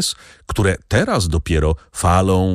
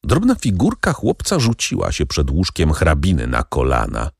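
usual speaking voice

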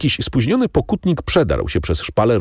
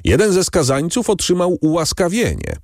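proclamation; 11 semitones higher than usual. A narrator's reading. Announcing pardon to crowd.

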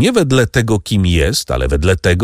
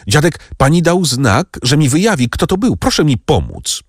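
high, eager appeal; urgent calling out; 7 semitones higher than usual. A narrator's reading. Franz asks Valentin to help him, calling out urgently. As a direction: press her for help, pitched up. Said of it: "zawołał Franz".

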